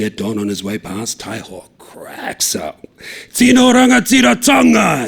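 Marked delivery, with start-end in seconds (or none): 1.2-2.8 slow whisper. A narrator's reading. Narration whispered. 3.3-5.1 huge high shout, long and falling; yelled. A narrator's reading. Taiho yelling after the fleeing cop, huge shout. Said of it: "he yells".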